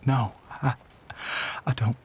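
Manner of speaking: faltering, gasping objection; panicked refusal. Acted. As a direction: refuse in alarm, breaking off each attempt. Panicked refusal of listener's offer.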